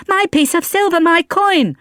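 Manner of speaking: put-on high child's wail; crying out. A narrator's reading. Boy crying to Valjean about his coin, high wail. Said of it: "the boy cried".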